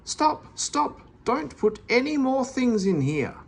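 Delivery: high-pitched frantic angry cry; losing his temper. A narrator's reading. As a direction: lose it completely. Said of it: "losing his temper".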